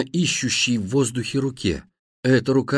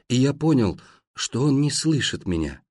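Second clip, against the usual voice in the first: plain reading voice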